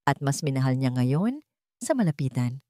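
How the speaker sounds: plain reading voice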